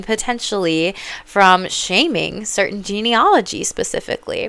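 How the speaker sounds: ordinary talk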